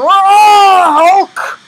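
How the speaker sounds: high, very loud mock roar; hammed-up monster shout; Hulk roar. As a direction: bellow like a strongman about to smash. Samson about to rage, acted.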